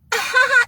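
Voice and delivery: high, coy mock giggle; little ladylike laugh. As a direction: imitate a woman's knowing little laugh. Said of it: "that little laugh ladies we give".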